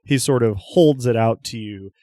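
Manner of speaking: ordinary talk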